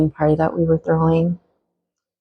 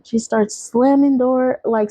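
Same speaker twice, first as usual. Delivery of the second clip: ordinary delivery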